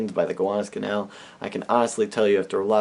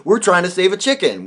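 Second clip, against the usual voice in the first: shouting back; 9 semitones higher, 6 dB louder than usual. Retold or imitated. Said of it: "And we shout back".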